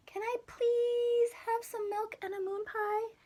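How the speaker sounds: put-on high childlike please; little boy pleading. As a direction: beg mom sweetly like a small kid. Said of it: "asked his mom".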